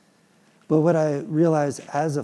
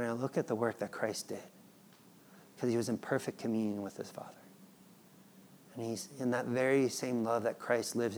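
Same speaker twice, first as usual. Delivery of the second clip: softer, intimate sermon whisper; hushed preaching; 3 semitones lower, 9 dB quieter than usual. Real, not acted. Preacher speaking of Christ's communion, hushed. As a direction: draw them in quietly.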